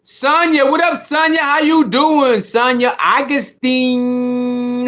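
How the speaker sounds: high, slow, drawn-out hype call; excited greeting. Real, not acted. Welcoming Sonya to the livestream, high and drawn out. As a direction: drag the full name out happily.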